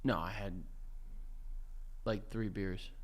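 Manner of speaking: low, mumbled tipsy husband; quiet drunk denial. Acted. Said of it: "You just sound, like, a little drunk".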